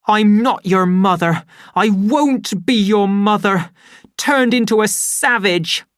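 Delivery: loud, high, bitter yelling; angry shout. Acted. Linda shouts at her son in bitter rage. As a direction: hurl it at him in rage. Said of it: "she shouted".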